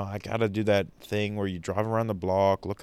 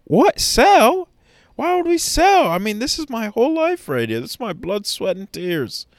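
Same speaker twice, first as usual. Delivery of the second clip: much higher, loud, shrieking What? Sell?; shocked disbelief; 13 semitones higher, 7 dB louder than usual. Retold or imitated. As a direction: panic at hearing the company is sold. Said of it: "you go".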